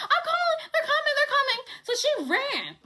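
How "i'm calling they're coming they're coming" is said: much higher, panicked, half-laughing; frantic Messy. Retold or imitated. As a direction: squeal it in a panic. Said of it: "She's like".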